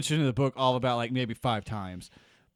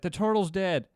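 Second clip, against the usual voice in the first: shouting; 8 semitones higher than usual. Retold or imitated. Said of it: "he starts shouting out loud".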